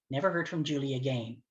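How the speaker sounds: normal talking voice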